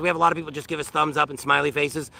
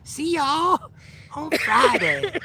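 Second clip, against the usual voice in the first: higher, slow, silly put-on sign-off; goofy sign-off voice; 8 semitones higher, slower than usual. Vlogger signs off to the P-ting Nation in a silly voice. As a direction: ham up the farewell in a cartoonish voice. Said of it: "talking like this".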